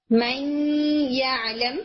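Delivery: من یعلم with the noon hummed nasally into ی; nasal ghunna merge. Shown as a demonstration. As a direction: carry the sound through the nose from من into یعلم. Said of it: "ناک میں آواز لے کے جاتے ہیں".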